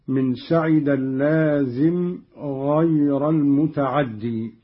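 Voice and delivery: ordinary talk, nothing marked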